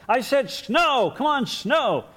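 slow, high, loud demand for snow; insistent kid shout. Pretend. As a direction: stamp it out like a cross kid.

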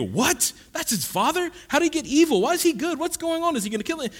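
high, rapid-fire shocked questions; shocked moviegoer. Retold or imitated. Stunned viewer reacting to the Vader reveal, shocked and high. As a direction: blurt it out in disbelief. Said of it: "And you go".

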